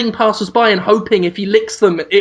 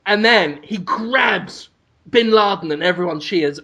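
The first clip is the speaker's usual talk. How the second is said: ordinary talk, nothing marked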